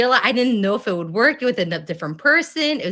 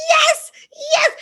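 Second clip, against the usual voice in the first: high, loud thrilled yes; high squealing delighted yes; excited yes; 4 dB louder than usual. Retold or imitated. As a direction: squeal it with joy. Excited answer acted in retelling.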